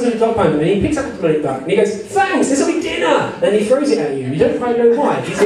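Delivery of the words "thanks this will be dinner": high-pitched, delighted guard voice; cheerful guard. Acted. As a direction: play a comically grateful guard, pitched up. Game master voices the guard's reply.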